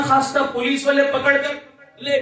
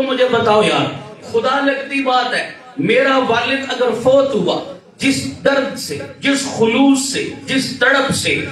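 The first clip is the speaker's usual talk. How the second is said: impassioned preaching; 7 dB louder than usual. Real, not acted. Preacher pleading loudly with the congregation. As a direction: pour feeling into every phrase. Preacher builds an emotional appeal.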